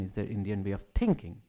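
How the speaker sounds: "thinking" weighted at the end; stress on THINKING. Shown as a demonstration. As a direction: put the emphasis on "thinking". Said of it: "An emphasis on each of the words".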